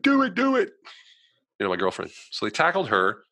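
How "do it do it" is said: very high, loud screechy egging-on; hammed-up high-pitched urging; mock goading shout. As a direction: imitate a girlfriend yelling to goad him. Girlfriend egging him on.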